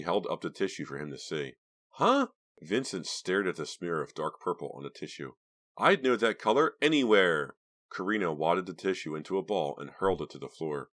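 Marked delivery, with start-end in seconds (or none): 1.9-2.3 confused. Acted. Confused at the tissue. 5.8-7.6 angry. Acted. Jealous girlfriend.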